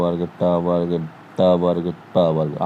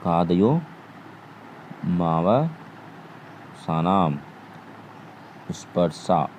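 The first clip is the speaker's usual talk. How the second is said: कादयो मावसानाम स्पर्शा spelled out syllable by syllable, very slowly and softer; slow recitation; 6 dB quieter, much slower than usual. Shown as a demonstration. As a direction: recite the sutra slowly for students to memorise. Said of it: "आप याद कर सकते हैं". (सूत्र को बहुत धीरे, एक-एक अक्षर अलग करके बोलें)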